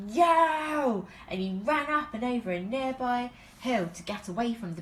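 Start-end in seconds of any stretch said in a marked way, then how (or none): 0.1-1.1 loud, high yelp; Daddy Bear stung again, yelling. A narrator's reading. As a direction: shout it as you flee. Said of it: "when Daddy Bear yelled".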